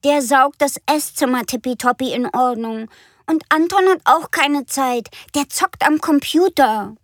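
put-on high, loud child voice; complaining little girl. Frieda telling Mama nobody has time, high child voice. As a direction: whine it like a small girl.

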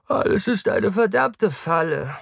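high, loud, stretched-out words; carefree yawner, drawn out and high; yawning. A narrator's reading. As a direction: say it through a lazy yawn. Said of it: "Taktus Ovalii Rath gähnt".

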